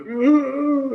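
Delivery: high-pitched pained moan; imitated whimper. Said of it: "making all these".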